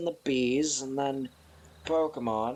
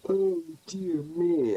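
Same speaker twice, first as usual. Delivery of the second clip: yawning; 5 semitones higher, 4 dB louder, slower than usual. Real, not acted. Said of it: "I have yawned yet again".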